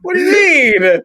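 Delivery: laughing. Real, not acted. Laughing while protesting.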